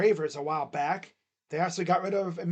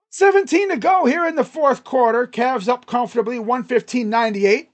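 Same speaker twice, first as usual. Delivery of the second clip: hyped announcer call; 5 semitones higher, 8 dB louder than usual. Real, not acted. Calling the score hyped.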